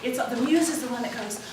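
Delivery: ordinary talk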